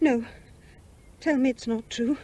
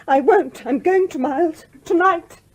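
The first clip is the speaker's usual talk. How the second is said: defiant outburst; 4 semitones higher, 7 dB louder, slower than usual. Acted. Defiant outburst before leaving.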